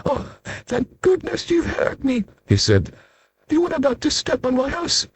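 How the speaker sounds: small and polite from down below; tiny voice. Acted. Tiny Mister Small introduces himself, high and soft.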